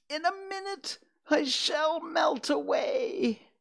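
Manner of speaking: very high, drawn-out wail; screaming witch. A narrator's reading. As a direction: screech in slow despair as a melting witch. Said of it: "she screamed".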